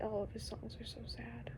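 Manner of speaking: soft whisper; whispered aside. Real, not acted. Telling viewers [name]'s songs are sad, whispered. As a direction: say it like a secret.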